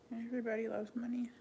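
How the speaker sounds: quiet repeat. Real, not acted. Echoing her own remark to viewers, softly. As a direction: drop the volume and murmur "Everybody loves money" to yourself.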